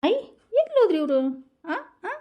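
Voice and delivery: high, loud searching call. Acted. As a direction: call out for him anxiously. Wife calls for vanished husband.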